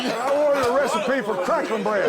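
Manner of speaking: cracking up over cornbread; laughing banter. Real, not acted. Joking about crackling bread recipe.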